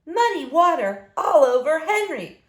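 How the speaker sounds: ordinary delivery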